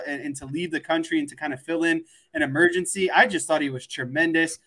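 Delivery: ordinary delivery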